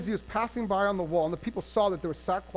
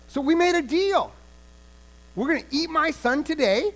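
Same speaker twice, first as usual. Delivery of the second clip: raised, insistent pleading to the king; indignant complaint; 3 semitones higher, 5 dB louder than usual. Acted. Woman presses the king for justice, loud and insistent. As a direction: argue the deal is owed to you.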